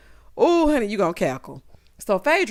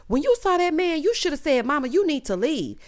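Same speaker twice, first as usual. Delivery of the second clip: mock scolding mom; 7 semitones higher than usual. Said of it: "she's like".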